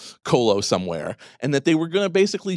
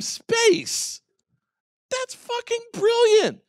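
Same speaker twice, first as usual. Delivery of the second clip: excited. Real, not acted. Excited run about the video.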